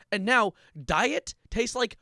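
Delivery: slow, loud, grossed out; slow disgusted emphasis. Real, not acted. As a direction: drag it out, disgusted. Diabetes diet soda rant.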